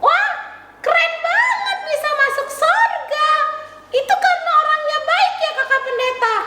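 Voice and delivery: high, cheery puppet voice; chirpy child puppet saying hello; puppet girl Tiur. Acted. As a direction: greet the kids as the little puppet.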